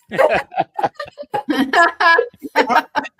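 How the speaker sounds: real laugh, high and loud; amused laugh at the husband's joke. Real, not acted.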